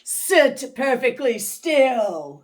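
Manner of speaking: slow, loud menacing command; stern witch order. Acted. Miss Swamp ordering the kids still, slow and menacing. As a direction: lay down the law slowly. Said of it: "said Miss Swamp".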